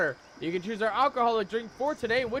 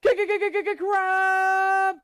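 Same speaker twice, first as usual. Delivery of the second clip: higher and louder, stuttering into a held crab rave; hype shout; 8 semitones higher, 5 dB louder, slower than usual. Acted. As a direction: whip the chat up, then belt crab rave. Hyping chat for crab rave.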